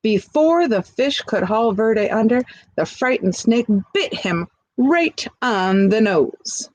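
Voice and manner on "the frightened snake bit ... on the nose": ordinary delivery, nothing marked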